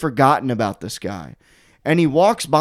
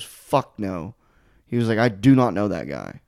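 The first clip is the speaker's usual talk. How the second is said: low, deadpan imitation of a friend; retold flat denial; 6 semitones lower, 3 dB quieter than usual. Friend denies knowing the guy, low deadpan. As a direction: drop low for the dismissive quote.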